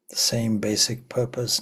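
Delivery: ordinary delivery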